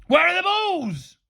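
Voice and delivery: slow, high, loud yell; frantic shouted demand. A narrator's reading. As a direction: yell it long and desperate. Frantic teacher demanding to see balls.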